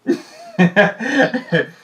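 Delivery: high bright laugh; sudden amused laugh; burst of laughter. Real, not acted. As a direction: laugh out loud at yourself. Laughing at own remark.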